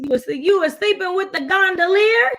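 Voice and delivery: lightly voiced husband, mock casual. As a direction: play the husband, cheerfully letting her off. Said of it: "And he like".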